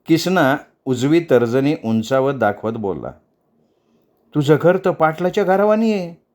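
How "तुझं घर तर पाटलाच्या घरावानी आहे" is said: put-on higher-pitched girlish amazement; a touch of astonishment; astonished. A narrator's reading. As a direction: say it in mild wonder, pitched up. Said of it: "कचरी अचंबित होऊन बोलली".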